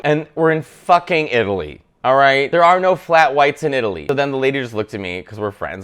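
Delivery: usual speaking voice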